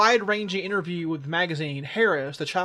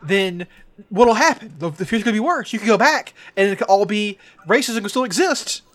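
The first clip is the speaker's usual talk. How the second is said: excited retelling; 4 semitones higher, 6 dB louder than usual. Retold or imitated. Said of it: "He's like".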